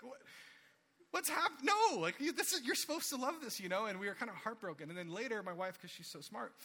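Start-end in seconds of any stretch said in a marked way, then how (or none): 0.0-3.9 high, loud dismayed protest; shocked disbelief. Retold or imitated. Parents protesting their daughter's verdict, high and loud. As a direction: parents baffled and hurt. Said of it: "We're like".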